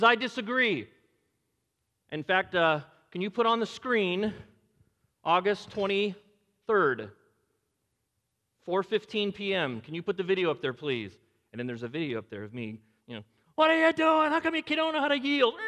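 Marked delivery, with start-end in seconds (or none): 0.0-0.9 higher, louder mock objection; mock Satan objection. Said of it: "he comes up and he says". 13.5-15.5 much higher, louder mock outraged shout; road rage yell. Video of himself yelling at driver.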